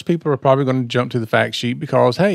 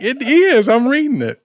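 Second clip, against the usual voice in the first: quoting self; 8 semitones higher than usual. Retold or imitated. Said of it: "And I'm like".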